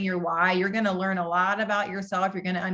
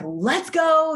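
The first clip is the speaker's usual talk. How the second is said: quick, higher burst of mock eagerness; eager let's go; 8 semitones higher, 5 dB louder than usual. New recruit eager to start, mock excited shout. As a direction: fire it off like a new recruit who can't wait. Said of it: "because you're excited".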